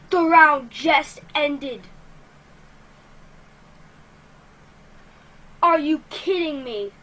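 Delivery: loud, indignant disbelief; exasperated. Real, not acted. Player killed as the round ends, loudly exasperated. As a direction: hit each word hard in exasperation. Round ended upon death.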